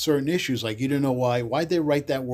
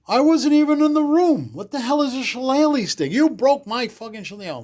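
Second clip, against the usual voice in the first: high, loud indignant cry of innocence; outraged protest; 10 semitones higher, 5 dB louder than usual. Retold or imitated. Kid blamed for broken stick, outraged high protest.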